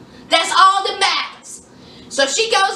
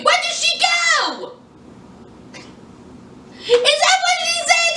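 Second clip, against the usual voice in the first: much higher disbelieving questions; incredulous squeal; 11 semitones higher than usual. Real, not acted. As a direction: shriek in amused disbelief. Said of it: "amused disbelief at the mom".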